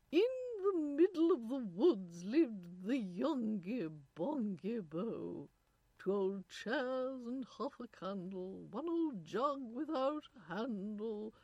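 slow, lilting English nurse reciting; pronounced English accent. A narrator's reading. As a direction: recite verse in plummy English tones. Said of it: "a woman with a pronounced English accent".

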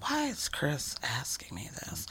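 unvoiced whisper of an inner question; whispered thought. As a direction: whisper it to yourself, puzzled. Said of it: "And I thought".

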